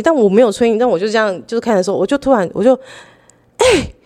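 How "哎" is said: a high, startled "ai"; sudden surprise. Retold or imitated. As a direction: gasp it out as if you just spotted something.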